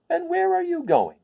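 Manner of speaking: squeaky eager scarecrow asking; very high curious scarecrow voice. Acted.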